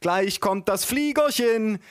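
put-on high, slow singsong baby talk; aunt feeding baby. A narrator's reading. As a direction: announce the little plane to a baby, slowly. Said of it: "ließ ihre Gabel durch die Luft".

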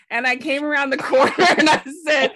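laughing through the setup; can't hold back the laugh while telling it. Real, not acted. Friend recounting meeting Pierre, laughing.

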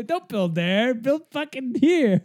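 high, slow, shouted demand; yelling across the room. Pretend. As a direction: bellow it across the room at dad. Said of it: "repeats the shout louder".

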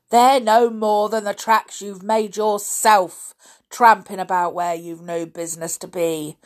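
put-on loud, cross old caretaker; angry. A narrator's reading. Caretaker telling off the boys for tramping about, angry. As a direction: scold with a touch of anger. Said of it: "he said angrily".

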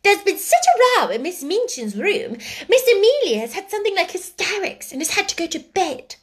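high-pitched, excited schoolgirl; animated, high voice; eager gossip. A narrator's reading. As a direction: spill the news of a scandal.

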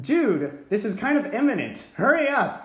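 high, increasingly loud urging; acted exasperated urgency; urgent prodding. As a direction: hurry him along, building to a call. Urging on a strolling technician.

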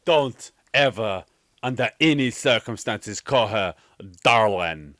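slow, low, drawn-out mock warning; mock heroine's warning. Mocking the heroine's line about being called darling, mock-solemn warning. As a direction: space it out gravely, hammed up. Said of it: "and she's like".

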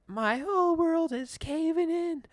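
very high, loud and slow; hammed-up despair; dramatic wail. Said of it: "Katrina's like".

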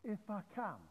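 frustrated echo. Acted. Jesus answering the father's doubt, mildly frustrated and incredulous. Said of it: "Jesus going".

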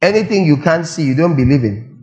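ordinary delivery

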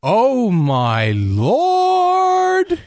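exasperated sitcom catchphrase, high and hugely drawn out; hammed-up straight-man outcry; Larry. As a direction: stretch "lord" out long and high in comic disbelief. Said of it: "whenever he would walk in and something ridiculous was happening, he'd say".

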